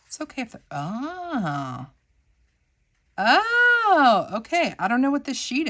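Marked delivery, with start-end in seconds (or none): 3.2-5.7 loud, high surprised oh; startled out loud; surprised exclamation. Real, not acted. Sudden surprise at the sheet.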